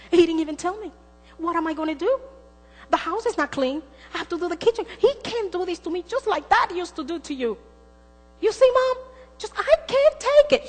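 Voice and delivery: high, loud, indignant venting; hysterical daughter. Retold or imitated. Daughter calls her mother about surprise dinner guests, hysterical. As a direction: build to a high, loud, exasperated peak. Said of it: "She was hysterical".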